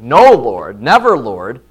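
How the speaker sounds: loud, emphatic protest; loud retold objection; Peter's protest. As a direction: push back hard on the Lord. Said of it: "so many times he's like".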